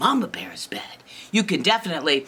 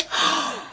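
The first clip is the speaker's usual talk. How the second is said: sharp theatrical intake of breath; big acted gasp; shocked gasp; 9 semitones higher than usual. Girl horrified by thrown-away toy, shocked gasp.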